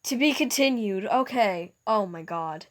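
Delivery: ordinary delivery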